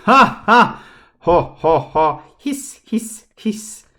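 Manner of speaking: high, loud cackling then hissing laughs; villainous laughs. A narrator's reading. Three villains gloat at Larka with evil cackling laughs. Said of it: "laughed with their most villainous laughs".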